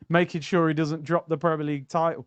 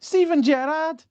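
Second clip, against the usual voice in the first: put-on high Scouse accent saying his own name; name him in a Liverpool accent; Scouse Gerrard voice; 11 semitones higher, 4 dB louder than usual. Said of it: "the quick voice with the Scouse accent".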